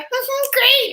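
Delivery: very high, loud squeak of fake cheer; mock tearful. She answers her friend on a date, mock tearful squeak. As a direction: imitate someone squeaking out "great" before crying. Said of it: "And starts crying".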